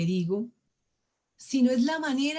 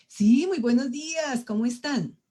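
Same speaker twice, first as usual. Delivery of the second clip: higher, lively good morning; upbeat greeting; 4 semitones higher than usual. Shown as a demonstration. Speaker showing the opposite tone of good morning, upbeat. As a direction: greet everyone with bright energy. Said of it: "digo todo lo contrario".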